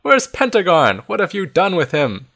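accusing shout. A narrator's reading. Child challenging wizards over Pentagon, shouted protest. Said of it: "you shout".

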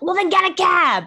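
high, loud mock Victorian gent; hammed-up Scrooge; posh old gentleman. Cohost voices Scrooge again, high and loud. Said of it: "And he's like".

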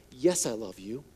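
soft, low, gentle reassurance; tender Jesus. Acted. Jesus answering fearful disciples, soft and tender. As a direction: comfort them quietly. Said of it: "answers that question for them".

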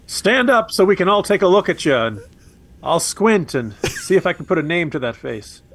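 mock the president calling him out; imitating Biden. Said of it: "quoting Biden's line".

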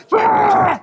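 big high crowd roar; crowd shout. Retold or imitated. Crowd answering [name], loud high roar. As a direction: yell it like a whole club answering. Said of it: "Everyone's like".

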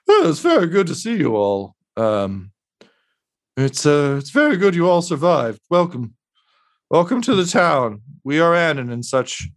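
a weary host with a sad smile; exhausted, beaten-down man. Acted. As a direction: greet them in a high, worn-out voice. Voices sad-smiling Mosifus Dirt.

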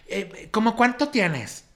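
high, slow, entitled questioning; mocking woman's voice. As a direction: drag it out like a demanding customer. Imitating the rude woman. (imitación lenta y aguda de la señora)

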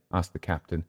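usual speaking voice

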